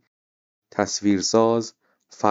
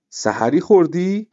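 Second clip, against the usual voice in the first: a surprised question, higher and louder; 9 semitones higher, 5 dB louder than usual. A narrator's reading. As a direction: ask it startled, pitched up. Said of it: "بابا تعجب کرد". (با تعجب و صدای بالاتر)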